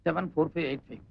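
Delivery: low, very soft echo of digits; murmured repeat. Acted. Man repeats the next officer's number while dialling, quiet murmur. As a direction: mutter the number to yourself as you dial.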